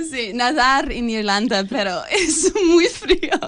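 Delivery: giggling through the words; laughing. Real, not acted. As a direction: laugh while talking. Laughing about cold swimming.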